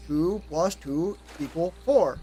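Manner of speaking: slow, even, robotic readout; flat calculator voice. Retold or imitated. Calculator answers the wrong guesser, robotic and slow. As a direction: read it like a machine would. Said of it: "you pull out a calculator and you go".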